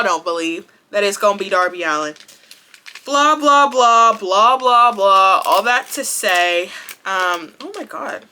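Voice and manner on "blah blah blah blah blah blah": dismissive blah. Real, not acted. Brushing off the obvious answer.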